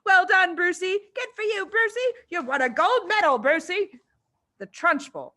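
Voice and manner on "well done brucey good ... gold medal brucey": high, loud crowd chant; excited children's cheer. A narrator's reading. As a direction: cheer the winner. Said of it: "yelling and clapping and shouting".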